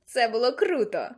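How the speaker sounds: joyful creature bragging; gleeful. A narrator's reading. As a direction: crow happily about the stunt. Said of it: "радісно повідомив він".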